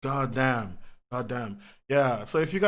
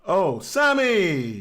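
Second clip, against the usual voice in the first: delighted greeting; 5 semitones higher, 5 dB louder, much slower than usual. Real, not acted. Greeting viewer arriving in stream.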